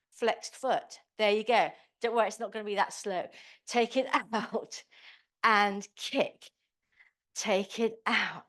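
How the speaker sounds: usual speaking voice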